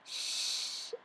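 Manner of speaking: whispered shush to keep quiet; breathy hush, no voice. Acted. Sneaking gorilla back quietly.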